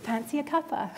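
fond, quiet memory of her offer; softly, with a hint of Scottish; gentle recall. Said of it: "my grandmother was able to say".

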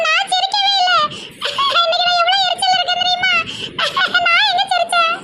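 high squealed denial; crazy laughing. Acted. Daughter denying to mother that she laughed, crazy laughing. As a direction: claim to be irritated while cackling. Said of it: "லூசு மாதிரி சிரிச்சிட்டு கிடக்க".

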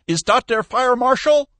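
put-on high, loud, accented agitation; puffing and sputtering. A narrator's reading. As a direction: sputter the question in alarm. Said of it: "puffing and sputtering into the phone".

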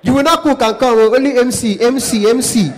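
high, loud, repetitive haggling; protesting caller. Acted. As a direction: hammer the point as an indignant client. Caller protests price of only MC.

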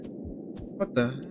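slow breathless whisper. Real, not acted. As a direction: whisper it, spooked. Spooked on the changed slide.

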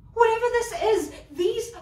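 loud and high, disgusted; scornful outburst. Acted. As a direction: spit it out in disgust. Mocking her own clothes.

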